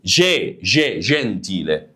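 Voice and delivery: "جي" repeated as "jeh", then slow "جنتيلة"; soft g demonstrated. Shown as a demonstration. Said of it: "بص قلنا إيه؟".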